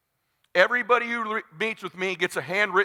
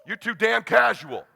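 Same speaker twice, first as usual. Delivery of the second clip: normal talking voice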